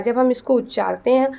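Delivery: usual speaking voice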